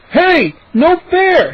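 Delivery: slow, high indignant protest; loud declaring. A narrator's reading. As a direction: object loudly, drawing out each word. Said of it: "he declared".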